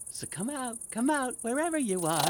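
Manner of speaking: high, soft taunting lure; coaxing in a light, high voice; teasing call. Acted. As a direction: call them out like hide-and-seek. Sing-song luring of hidden pests.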